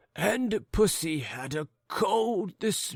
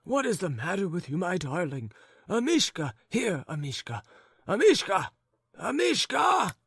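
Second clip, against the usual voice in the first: put-on calling old gentleman; 9 semitones higher than usual. A narrator's reading. Old husband calling the dog from under the bed, high shout. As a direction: call the dog, rising to a shout. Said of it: "cried the old gentleman".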